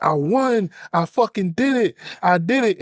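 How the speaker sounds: high, elated victory cry; excited yelling. Retold or imitated. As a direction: celebrate like you just won. [name] celebrating backstage.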